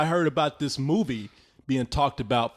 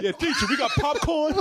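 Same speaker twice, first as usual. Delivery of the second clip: mock kid voice; 11 semitones higher than usual. Mocking classroom kid.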